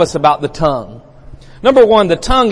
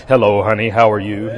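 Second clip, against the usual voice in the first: mock preacher voice to wife; 7 semitones lower than usual. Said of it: "if it's like".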